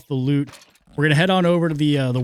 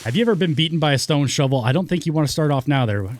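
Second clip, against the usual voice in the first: ordinary talk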